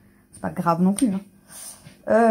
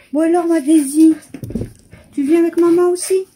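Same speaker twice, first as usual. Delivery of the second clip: calling the cat; 7 semitones higher, 7 dB louder than usual. Real, not acted. Calling her cat to come.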